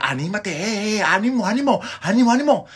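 rising, high-pitched cheering; lively imitation of an encouraging crowd. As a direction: egg him on with growing energy. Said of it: "ahora sí le dijeron".

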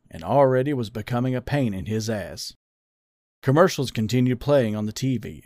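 plain reading voice

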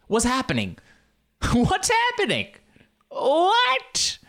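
climbing into a very high squeal; badly lost accent shooting up in pitch; mock bewilderment. Host baffled by his own accent, squealed mock bewilderment. Bad accent spirals out of control.

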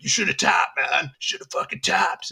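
high, whiny scolding friend, the last words half-whispered. Retold or imitated. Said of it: "he was like".

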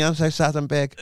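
high, loud comic character voice; mock sad voice. Voicing the oversized body part, high mock-sad voice. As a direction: give the sorry giant a high put-on voice.

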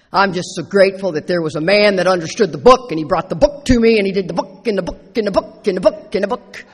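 parroting a know-it-all newcomer with a touch of mockery; mocking the kid. Sponsor mimics the overeager kid at the meeting, mildly mocking. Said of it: "and he goes".

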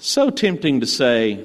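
ordinary talk

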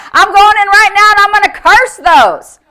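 high, full-volume angry retort; defiant shout. Acted. Norval shouts down the devil's voice, furiously defiant. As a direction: yell it down and refuse to be stopped.

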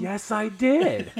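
high, loud, slow confession; drawn-out, high-pitched admission; emphatic admission. Real, not acted. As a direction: own up loudly, stretching each word. Owns up after being challenged.

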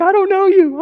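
hammed-up alarmed stranger; mock scared woman. Woman's scared reply retold.

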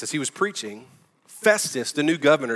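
ordinary talk, nothing marked

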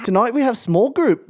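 mocking a friend's Irish accent, pitched high; a breezy, lilting Irish accent; playful Irish accent. To a co-host trying an Irish accent, an Irish accent mocked high. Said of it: "That was pretty good".

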